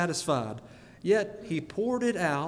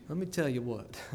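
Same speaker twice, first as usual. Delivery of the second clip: normal talking voice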